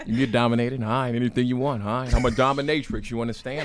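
usual speaking voice

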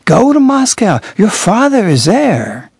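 bishop's benevolent way. Retold or imitated. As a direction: say it with loving benevolence. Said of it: "That's exactly how he said it".

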